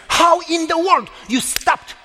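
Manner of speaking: high, stretched-out disbelief; incredulous, pitched well up; astonished question. Retold or imitated. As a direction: re-enact asking in amazement, slowly. Retold astonished question to wife.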